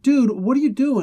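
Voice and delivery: mock exasperated. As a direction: call him out, incredulous.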